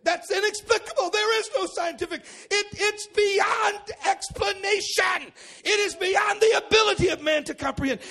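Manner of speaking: high, loud, shouted preaching; preaching at full force. Real, not acted. Pastor preaching on the light, full-force shout. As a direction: thunder it to the congregation.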